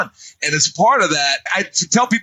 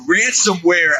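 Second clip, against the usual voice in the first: usual speaking voice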